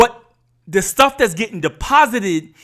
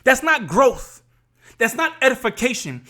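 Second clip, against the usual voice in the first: ordinary delivery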